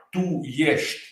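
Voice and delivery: "tu ești" in careful Romanian, ești as yesht; Romanian words. Shown as a demonstration. [name] demos a Romanian word he knows in careful Romanian. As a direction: drop in a Romanian phrase to show what you know. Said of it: "you are".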